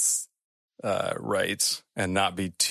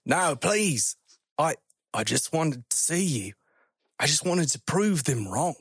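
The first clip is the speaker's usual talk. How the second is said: higher-pitched, halting plea; earnest, raised voice, stumbling; pleading explorer; 7 semitones higher than usual. Acted. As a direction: plead with the creature.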